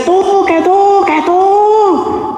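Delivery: high, drawn-out accusing shouts; mock angry yelling. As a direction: imitate someone yelling blame, stretching each word high. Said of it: "Si a usted le gritan y le dicen". (gritos fingidos de reproche)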